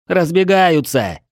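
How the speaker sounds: high, drawn-out report; lookout's shout. A narrator's reading. Lookout tells those below about the attackers, high long call. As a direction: call it down from afar.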